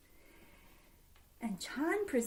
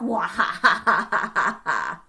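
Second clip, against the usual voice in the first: greedy cackle; 5 semitones lower, 5 dB louder than usual. Acted.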